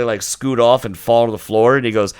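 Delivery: ordinary talk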